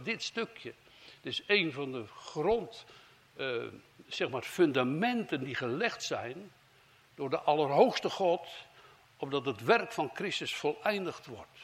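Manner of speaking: ordinary delivery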